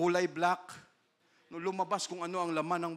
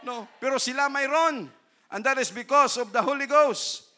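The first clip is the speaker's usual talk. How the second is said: much louder, higher preaching; preacher at full force to congregation; 6 semitones higher, 10 dB louder than usual. Acted. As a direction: preach it with fire.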